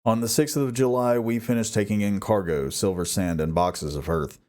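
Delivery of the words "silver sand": usual speaking voice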